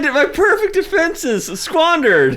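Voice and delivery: high, loud hammed-up horror; mock panic. Hero sees Bill Gates jump the chairs, mock panic. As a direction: wail in melodramatic dismay. Acted panic as defenses fail.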